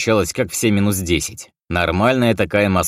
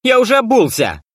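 higher, louder angry yell; angry shout; 6 dB louder than usual. A narrator's reading. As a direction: snap it out, fed up. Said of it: "откровенно злясь, выкрикнул я".

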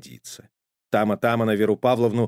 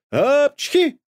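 huge high loud sneeze; booming cartoon sneeze; thunderous sneeze; 6 dB louder than usual. A narrator's reading. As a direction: sneeze so the house shakes. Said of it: "снова сотрясся дом".

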